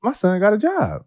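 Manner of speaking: high, bursting with pride; excited. Real, not acted. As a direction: announce it, thrilled. Proud excited announcement.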